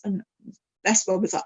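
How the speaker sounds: ordinary delivery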